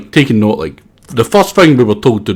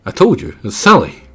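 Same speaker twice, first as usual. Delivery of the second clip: ordinary talk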